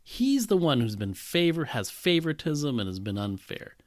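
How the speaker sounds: usual speaking voice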